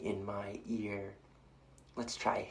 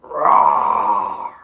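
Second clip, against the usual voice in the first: big loud roar; loud lion roar for the kids; 2 semitones lower, 15 dB louder than usual. Shown as a demonstration. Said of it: "Let's try roaring like a lion".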